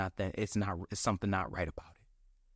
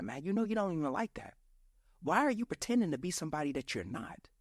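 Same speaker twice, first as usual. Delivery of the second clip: higher, exasperated scolding of yourself; mock self-reproach, pitched up; self-scolding inner voice; 7 semitones higher than usual. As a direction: nag yourself like your own harshest critic. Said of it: "I would be like".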